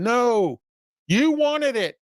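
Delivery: high, pointed accusation on you; indignant retort. Real, not acted. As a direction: throw it back at them, voice up high. Scolding the complainers.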